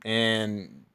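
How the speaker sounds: ordinary talk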